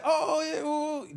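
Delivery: high excited oohs. Retold or imitated. Old teacher excited recognition.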